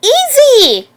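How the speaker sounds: high, urgent warning; urgent call. A narrator's reading. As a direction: call it out sharply to steady the animal. Calming a growling creature, urgently.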